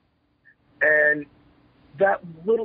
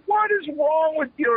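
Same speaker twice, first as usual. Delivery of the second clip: high-pitched exasperated yell; retold yelling; 13 semitones higher than usual. Wrestler yells at his chief for turning down the job, exasperated. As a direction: re-enact yelling at him, pitch way up. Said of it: "I start yelling at him".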